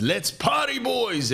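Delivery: high-pitched hyped-up party call; rally the boys for a big night; hammed-up wild mate; party-boy cheer. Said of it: "slaps the table and he goes".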